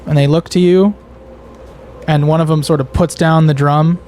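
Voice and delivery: ordinary talk, nothing marked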